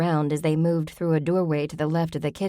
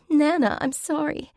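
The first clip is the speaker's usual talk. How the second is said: much higher, tearful break; sobbing; 11 semitones higher than usual. A narrator's reading. Mary apologizing to her grandmother while leaving, sobbing. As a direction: sob out the apology. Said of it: "Mary sobbed".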